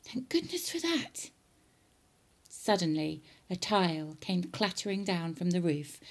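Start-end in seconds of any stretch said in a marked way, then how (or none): 0.0-1.3 high, soft aside as George; boy's private relief, high-pitched; relieved thought. Acted. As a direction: think it aloud, high and hushed. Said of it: "George thought".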